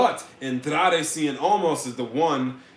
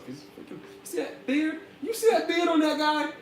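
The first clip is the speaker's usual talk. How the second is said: excited high voice; 12 semitones higher than usual. Real, not acted. Gushing over Rusev's beard.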